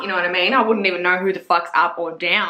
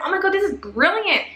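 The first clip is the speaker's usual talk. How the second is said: thrilled gush; 9 semitones higher than usual. Retold or imitated. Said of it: "I was like".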